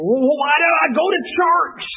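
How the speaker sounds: high, loud exasperated cry retold; frustrated outburst. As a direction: mimic the frustrated lady shouting at church talk. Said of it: "she just got real frustrated".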